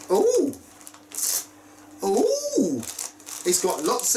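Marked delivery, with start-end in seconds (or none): 0.1-0.5 high-pitched "ooh!"; a high little coo of delight; excited ooh. Real, not acted. Vlogger looking into a bag of goodies, excited ooh. 2.0-2.8 long high "oooh!"; excited ooh. Real, not acted. Vlogger finding little goodies in the bag, excited ooh.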